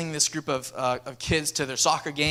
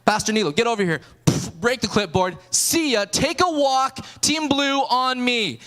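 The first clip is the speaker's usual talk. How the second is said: louder, barking orders, the last lines slow; fired-up commands; 5 semitones higher, 4 dB louder, slower than usual. Retold or imitated. Pastor takes over the team, calling out orders fired up. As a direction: take charge like an angry coach. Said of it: "now I'm just fired up".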